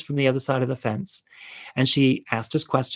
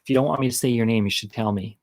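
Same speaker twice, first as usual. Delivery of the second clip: normal talking voice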